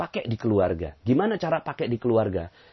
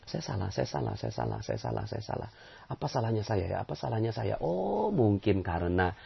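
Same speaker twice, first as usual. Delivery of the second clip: quiet introspective murmur; 7 dB quieter than usual. Shown as a demonstration. Preacher models introspection after losing a new bag. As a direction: murmur it inward, searching for the fault. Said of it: "Jadikan ini alat introspeksi".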